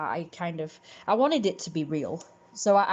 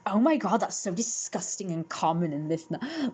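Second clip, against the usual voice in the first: mock Valley Girl disgust, dropping off at the end; Valley Girl gossip. Imitating mean girls talking about people, mock Valley Girl disgust. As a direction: imitate a scandalized mean girl, pitch swooping up. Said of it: "like Valley Girls in, like, things like Mean Girls".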